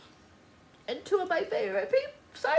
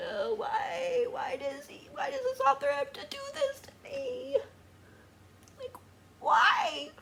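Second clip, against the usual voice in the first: high, soft tearful whine; quiet high-pitched weepy lament; crying; 10 semitones higher, 5 dB quieter than usual. Real, not acted. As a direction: plead why through real tears. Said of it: "just can't quit crying".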